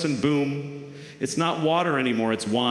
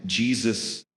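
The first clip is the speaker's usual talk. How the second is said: ordinary talk, nothing marked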